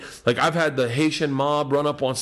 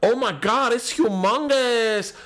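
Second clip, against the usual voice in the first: higher, slow mock shout; girl yelling into the mic; 9 semitones higher, slower than usual. Girl in green room yells into mic, mock astonished. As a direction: stretch humongous, pitched up. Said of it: "she yells in the microphone".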